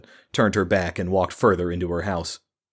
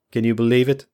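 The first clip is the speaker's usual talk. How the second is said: bitter scoff; 4 semitones higher, 4 dB louder, faster than usual. Acted. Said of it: "Another snorted laugh".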